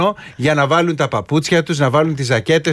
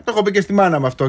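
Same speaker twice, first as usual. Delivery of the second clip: ordinary talk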